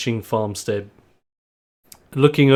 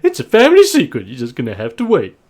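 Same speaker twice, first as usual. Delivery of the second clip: Maud; 5 dB louder, faster than usual. Acted. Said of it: "just looks over to you and just goes".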